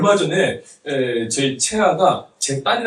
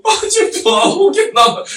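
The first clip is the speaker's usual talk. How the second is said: loud, high mock wail; imitated child's crying; 6 dB louder, slower than usual. Preacher mimics daughter crying over writing her name, exaggerated wail. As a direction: drag it out like a wailing child. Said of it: "이러고 울고 있는 거예요".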